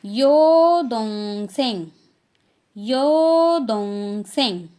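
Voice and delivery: "यो दोंग सेंग" slow and loud; teacher modelling Korean term; Korean word. Shown as a demonstration. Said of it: "तो younger sister".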